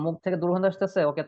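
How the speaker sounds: normal talking voice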